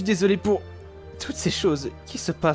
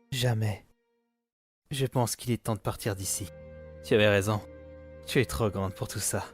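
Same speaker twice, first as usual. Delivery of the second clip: low, soft, subdued voice; gently concede, keeping it low; tender resignation; 7 semitones lower, 7 dB quieter than usual. Acted. Father admitting his daughter was right, tender and subdued.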